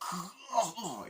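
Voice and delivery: high falling giggle; high mock laugh. Sitting down, high mock giggle. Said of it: "they're sitting down like".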